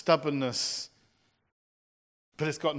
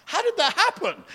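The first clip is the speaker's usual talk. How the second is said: high, louder mock shock; 13 semitones higher, 4 dB louder than usual. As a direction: gasp it out in stunned disbelief. Mimicking a shocked spouse.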